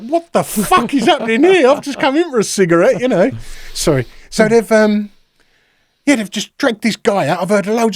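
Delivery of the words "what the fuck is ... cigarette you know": high, louder, baffled outburst; indignant protest. Retold or imitated. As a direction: reenact a startled, indignant protest. Retells his startled protest to officers.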